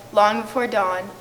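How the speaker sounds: ordinary talk, nothing marked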